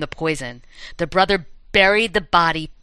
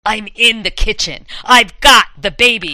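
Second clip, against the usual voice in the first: louder, raised threatening voice; menacing caller; 4 semitones higher, 7 dB louder than usual. A narrator's reading. Mystery caller tells babysitter the baby is taken, menacing. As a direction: build to a loud threat.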